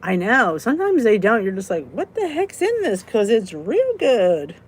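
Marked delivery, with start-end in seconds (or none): 1.9-3.0 high, baffled wondering; puzzled. Retold or imitated. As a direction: act baffled by the taste. Imitating confused eater.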